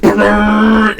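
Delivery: loud, high foghorn blast; imitated foghorn. Doorbell going off as the players walk in, blaring foghorn. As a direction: blare one long held note like a foghorn. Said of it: "foghorn goes off".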